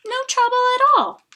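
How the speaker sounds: high, fast coaxing bird voice; wheedling bird. Acted. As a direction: coax him sweetly and quickly. Maisie wheedling the elephant.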